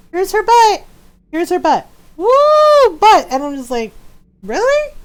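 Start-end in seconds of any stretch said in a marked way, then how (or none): 0.1-3.3 leering game voice. Retold or imitated. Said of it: "you're just like". 4.4-4.9 incredulous really. Retold or imitated. Incredulous reaction to camera.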